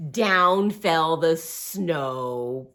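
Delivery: very slow, low storytelling; slow telling. Acted. Reading to kids, very slow falling line. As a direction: stretch each word as snow falls.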